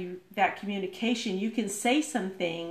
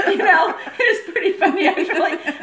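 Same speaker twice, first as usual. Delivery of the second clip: loud, high and laughing; laughing retelling; 9 semitones higher, 12 dB louder than usual. Retold or imitated. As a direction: laugh through a re-enacted phone chat. Retelling phone chat, laughing.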